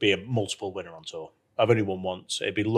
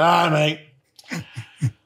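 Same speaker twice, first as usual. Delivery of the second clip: put-on higher, louder, merry drunk greeting; drunk mate; 6 semitones higher, 4 dB louder than usual. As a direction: greet him like a cheerful tipsy pal. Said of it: "he's a bit drunk".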